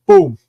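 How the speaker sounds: a loud punchy boom; loud imitated gunshot; mock gunshot boom. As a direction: blurt it like a gunshot. Comic gunshot sound effect.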